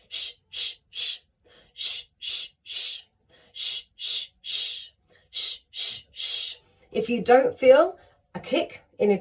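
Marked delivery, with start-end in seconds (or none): 0.1-6.6 short, sharp unvoiced sh pulses from the gut; pulsed sh sounds. Shown as a demonstration. Coach showing students the diaphragm exercise, pulsed sh. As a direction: kick each sh out from the diaphragm. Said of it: "We're gonna pulse them out like this".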